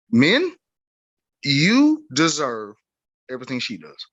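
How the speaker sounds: rallying, slowed you deserve; emphatic call out. Real, not acted. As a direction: call them out and stretch it. Emphatic appeal to men.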